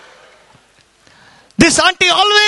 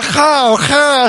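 stylish, breath-led "ha, ha" caw; Western-accented crow caw. Retold or imitated. Preacher mimicking American crows, stylish accented caw. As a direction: caw "ha, ha" with a little foreign style. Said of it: "With accent".

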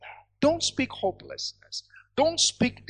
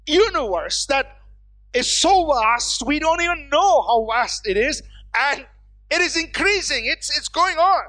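raised, insistent pulpit delivery; higher-pitched, fervent sermon voice; impassioned preaching; 5 semitones higher, 5 dB louder than usual. Real, not acted. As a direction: press the big question on the congregation. Preaches at full force.

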